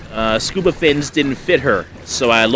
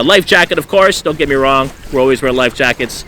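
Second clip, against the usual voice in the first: usual speaking voice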